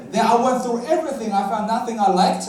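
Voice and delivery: normal talking voice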